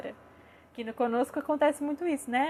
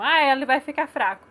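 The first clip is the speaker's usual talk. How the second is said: louder voiced protest of a doubter; mock objection; 2 semitones higher, 7 dB louder than usual. Sceptical parent objecting to comforting children, mock protest. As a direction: imitate a worried sceptic's complaint.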